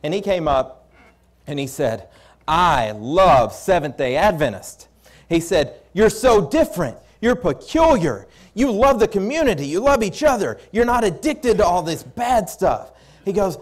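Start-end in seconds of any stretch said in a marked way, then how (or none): 2.5-4.9 slow, loud, emphatic declaration of love; enthusiastic praise. Retold or imitated. Mayor praising the visiting church to the preacher, enthusiastic. 5.9-12.9 high, loud gushing admiration; enthusiastic praise. Retold or imitated. Mayor marvelling at how different these people are, enthusiastic.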